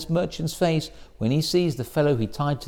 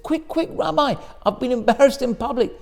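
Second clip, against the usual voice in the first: higher, hurried and urgent; 8 semitones higher than usual. Retold or imitated. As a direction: rush to tell him. Rushing to the Rebbe.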